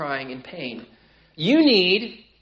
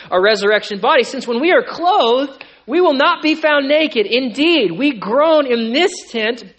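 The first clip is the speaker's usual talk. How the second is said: loud, higher preaching build; impassioned sermon climax; full-force preaching; 7 semitones higher, 13 dB louder than usual. Acted. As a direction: drive the sermon to its peak. Preaching on the resurrection body.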